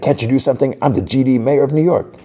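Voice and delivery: high-pitched retold yell, no real volume; acted outrage with the voice pushed up; mock yelling. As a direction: imitate an indignant mayor berating his driver. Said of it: "the mayor is yelling at his driver".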